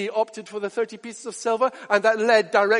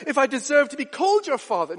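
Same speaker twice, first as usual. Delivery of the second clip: high, pained plea; pleading father; 7 semitones higher, 4 dB louder than usual. Retold or imitated. Father questioning daughter's love before the governor, high pained plea. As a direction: plead with wounded pride. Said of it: "burst out".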